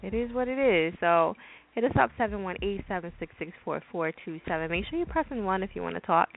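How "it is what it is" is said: shrugging it off. Retold or imitated. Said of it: "Or do you just say".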